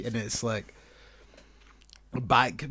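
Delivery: ordinary talk, nothing marked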